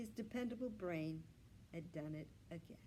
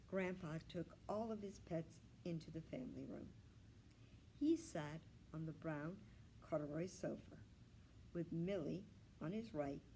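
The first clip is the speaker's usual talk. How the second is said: ordinary delivery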